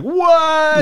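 high, loud disbelieving yelp; mock shock. Fan reacting to a character's death, loud shocked yelp. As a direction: squawk it as if you can't believe it. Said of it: "it was like".

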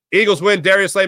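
normal talking voice

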